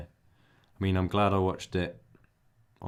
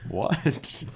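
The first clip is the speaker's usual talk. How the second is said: high, rising mock disbelief; teacher's baffled "what"; 8 semitones higher, 5 dB louder than usual. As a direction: act the baffled teacher, pitched up and loud. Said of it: "She's like".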